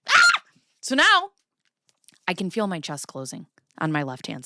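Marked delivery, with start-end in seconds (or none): 0.1-0.4 sudden loud high yelp of pain; a sharp loud gasp-scream; startled scream. Retold or imitated. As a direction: cry out as if struck by a chest pain. Sudden pained scream opening story.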